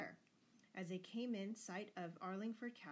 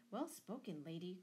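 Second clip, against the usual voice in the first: ordinary delivery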